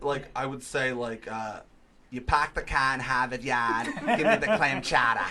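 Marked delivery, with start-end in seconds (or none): 2.1-5.2 put-on a Philly guy's light Boston accent, dropped r's, louder; hammed-up Boston accent with a laugh; Aunt Mary Pat Boston accent. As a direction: order the chowder in a so-so Boston accent. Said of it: "Philly doing a Boston accent".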